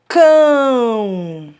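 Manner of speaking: "cão" held long as a single sound; drawn-out, emphatic "cão"; one long syllable. Shown as a demonstration. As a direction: stretch cão out in one slow beat. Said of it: "Mono significa um".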